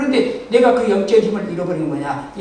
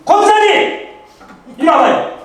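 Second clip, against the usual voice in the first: loud, high angry summons; angry call; 9 semitones higher, 9 dB louder, slower than usual. Retold or imitated. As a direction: call her over, fuming. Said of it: "핏발이 확 쓰는 거예요".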